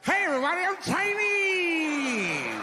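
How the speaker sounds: high, drawn-out sing-song announcement. Retold or imitated. Mocking the crowd with a drawn-out high shout. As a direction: ham up a cheesy caricature shout. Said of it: "making that face".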